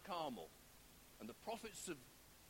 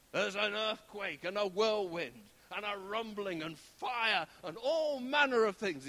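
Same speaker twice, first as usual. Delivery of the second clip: loud, building, drawn-out drama; dramatic storm telling; 7 dB louder than usual. Retold or imitated. Dramatising the earthquake and whirlwind.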